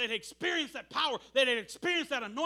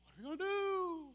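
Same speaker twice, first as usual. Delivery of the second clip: ordinary talk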